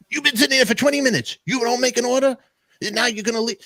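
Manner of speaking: angry soup Nazi yell, high and loud; retold high-pitched tirade; intense yelling. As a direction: yell like a restaurant owner throwing customers out. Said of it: "he's yelling at them, like, intense".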